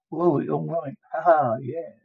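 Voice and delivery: ordinary talk, nothing marked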